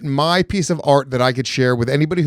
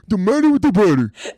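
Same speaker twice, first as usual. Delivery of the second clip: mocking dopey voice; 8 semitones higher than usual. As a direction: parrot it back in a dopey voice.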